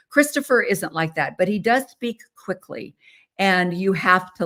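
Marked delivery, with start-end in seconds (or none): none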